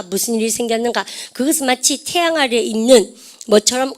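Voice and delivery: ordinary talk, nothing marked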